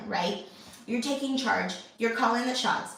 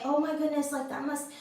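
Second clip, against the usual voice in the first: put-on comforting a child; 4 semitones higher than usual. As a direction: gently soothe a hurt kid. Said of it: "I have to be able to say".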